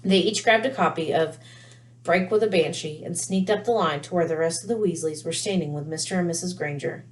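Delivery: ordinary delivery, nothing marked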